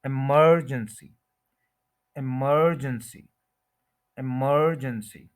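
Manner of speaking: Emergency with a long, strong MER; emergency slowly, i-MER-jen-si; stress on second syllable. Shown as a demonstration. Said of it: "इस पे stress करना है".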